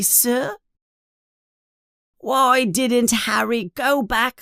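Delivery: high, squeaky elf voice, sad and pleading; pleading house elf. Acted. Dobby the house elf pleads with Harry, high and squeaky. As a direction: plead with him like a worried little elf.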